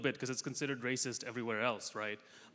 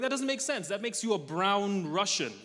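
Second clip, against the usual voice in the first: skeptical locals; 6 semitones higher than usual. Retold or imitated. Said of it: "they're like".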